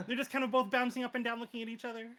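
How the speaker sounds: ordinary delivery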